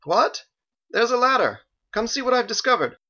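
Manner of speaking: high, loud holler from below; calling up. A narrator's reading. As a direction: shout up from the bottom of a pit. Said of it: "Michael called up again".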